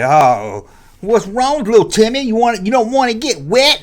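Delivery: taunting bully. Retold or imitated. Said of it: "They're gonna say".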